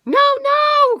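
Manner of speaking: very high, loud frantic cry; panicked porridge bowl. A narrator's reading. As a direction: shriek no like a frightened bowl. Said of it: "cried Morris the porridge bowl".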